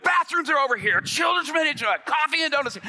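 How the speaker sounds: very high, loud mock-panicked shouts; frantic announcing. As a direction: shout out announcements like a nervous moving target. Frantic announcer dodging a gunman.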